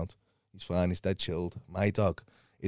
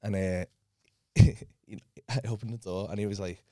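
ordinary talk, nothing marked

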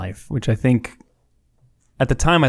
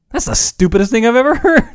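incredulous laughing scoff; 10 semitones higher than usual. Retold or imitated. Teenage Dave scoffing at McConaughey's note, high and laughing. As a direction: laugh off a dumb autograph in disbelief. Teen mocking a celebrity's autograph.

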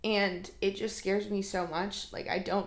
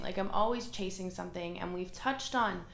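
ordinary delivery

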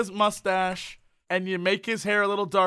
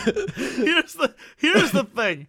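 mock radio voice; 4 semitones higher, 5 dB louder, slower than usual. Putting on a voice.

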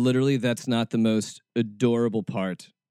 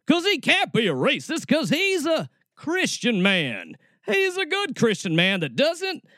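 mocking sheriff; 11 semitones higher than usual. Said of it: "mocking the sheriff".

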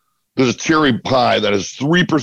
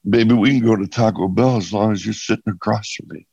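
smooth charm; 6 semitones lower, faster than usual. Retold or imitated. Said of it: "that was slick".

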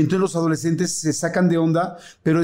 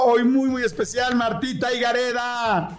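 higher, upbeat host welcome; show host intro; 7 semitones higher than usual. Acted. Host welcoming listeners and introducing his guest: upbeat presenter voice. Opens the podcast episode.

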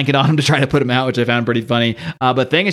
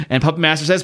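usual speaking voice

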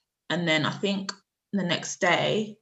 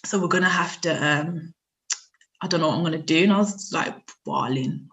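ordinary talk

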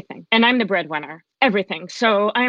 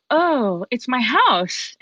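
high, slow gasp of wonder; stretched-out, awestruck and higher-pitched; delighted recognition; 4 semitones higher, much slower than usual. Retold or imitated. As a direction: retell falling in love at first sight. Walks in and recognizes her house.

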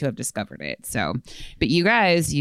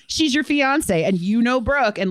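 ordinary talk, nothing marked

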